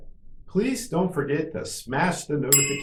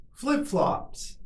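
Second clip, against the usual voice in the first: much higher, shorter take; quicker model; 12 semitones higher than usual. Shown as a demonstration. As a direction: say it quickly on a raised pitch. Teacher models word for repetition.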